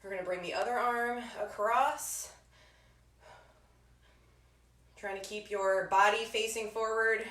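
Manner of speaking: soft calm stretching cues; calm cool-down voice. Acted. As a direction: ease them down gently.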